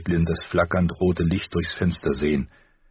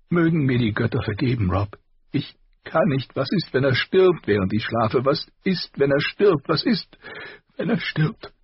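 higher, tearful and frantic, climbing with each question; weeping, rising panic; 8 semitones higher than usual. A narrator's reading. Caitlin fears Bran dies while she sleeps, weeping in panic. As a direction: beg through tears as fear takes over. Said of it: "weinte sie".

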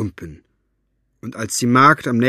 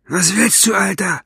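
gruff Buster voice; 9 semitones higher, 4 dB louder than usual. Acted.

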